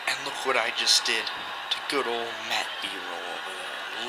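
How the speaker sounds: quiet, cold heel promo; menacing wrestler promo. Acted.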